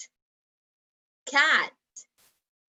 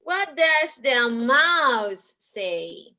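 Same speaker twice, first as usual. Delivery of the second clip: very slow, stretched words; slow question; much slower than usual. Shown as a demonstration. Teacher asking children about the mouse, very slowly. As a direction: space out each word for learners.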